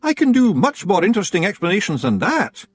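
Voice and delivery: high, uneasy Scots voice; stalling nervously; uneasy Rob. A narrator's reading. Rob offers explanations.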